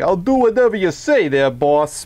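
put-on obey the boss like an old-time mobster; old-school gangster henchman. Host imitating the dummy henchman for the actor, gangster voice. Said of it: "he's like the old school".

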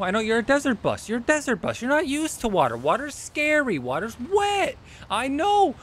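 put-on high, soothing baby talk to the bus; coaxing a scared pet. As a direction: sweet-talk it like a frightened animal.

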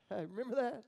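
ordinary delivery

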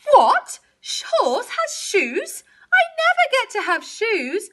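high, indignant cow outburst; loud shocked start into a high whine; bawling cow. A narrator's reading. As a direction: bawl it as a jealous cow. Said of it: "bawled Cow".

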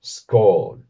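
skål as a loud, falling demonstration with a rounded vowel; Old Norse pronunciation. Shown as a demonstration. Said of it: "se pronunciaría de esta manera".

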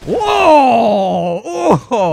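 loud startled exclaim; excited shout. Real, not acted. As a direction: cry out at the explosion. Explosion in game.

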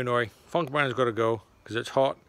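ordinary talk

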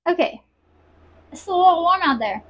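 ordinary delivery

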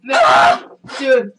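loud pained protest. Real, not acted. Reacting after puking it up.

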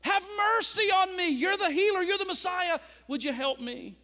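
high, loud desperate plea; crying out. Acted. Blind Bartimaeus cries out to Jesus, loud desperate plea. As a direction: cry out for mercy with all you have. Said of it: "he began to cry out".

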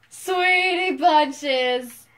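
teasing drawl. Pretend. Teasing with slow pet name.

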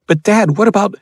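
a scared son pleading with his dad; boy's voice. Acted. Son interrupts his father, high and anxious.

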